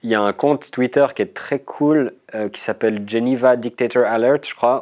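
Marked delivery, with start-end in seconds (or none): 3.1-4.4 fluent English pronunciation of the account name; perfect English accent. Real, not acted. Swiss guest naming a Twitter account, in a polished English accent. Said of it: "The perfect accent".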